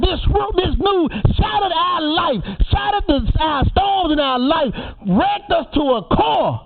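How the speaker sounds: high, rising preacher's call; preaching climax. Acted. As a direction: preach it out to the mourners. Funeral eulogy preaching at full force.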